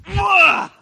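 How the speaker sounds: loud, high effortful grunt; frustrated grunt. Acted. Simon flinging the net out, angry grunt of frustration. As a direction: heave it out in anger. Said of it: "Out of frustration, anger".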